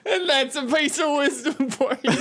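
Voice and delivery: laughing. Acted. Laughing through prospector line.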